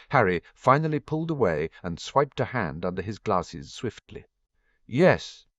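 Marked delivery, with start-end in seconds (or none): 4.9-5.5 voice breaking. A narrator's reading. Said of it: "His voice broke on the word".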